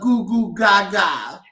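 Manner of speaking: sing-song goo goo gaga. Real, not acted. Baby talk to infant.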